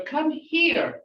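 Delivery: command. Retold or imitated. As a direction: order the child over. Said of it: "that's a command".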